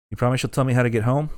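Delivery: ordinary talk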